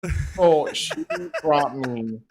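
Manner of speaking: usual speaking voice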